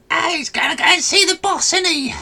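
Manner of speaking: very high-pitched, cackling goblin voice; gleeful goblin. Acted. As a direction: squeal it like a gloating little goblin. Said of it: "this goblin just laughs, and he's going".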